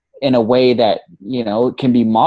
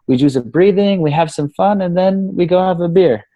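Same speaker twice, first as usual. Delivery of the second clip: high, hammed-up Austrian accent; terrible Austrian accent; 7 semitones higher than usual. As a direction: do a bad, cheery Austrian accent.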